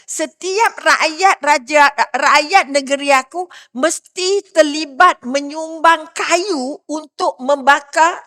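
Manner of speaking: slow, loud royal command; king's harsh decree. Retold or imitated. Namrud ordering his people, slow loud decree. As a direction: proclaim it like a stern tyrant king. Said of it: "Raja perintah Nabi Namrud kata apa?".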